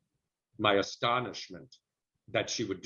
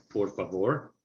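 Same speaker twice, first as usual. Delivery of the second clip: De Niro-style. Shown as a demonstration. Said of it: "that's why I'm kind of going like".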